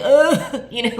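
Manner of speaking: laughing groan at herself; loud laughing ugh. Real, not acted. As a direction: laugh off the ugly cry. Laughs about ugly crying.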